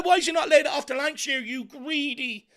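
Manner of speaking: ordinary talk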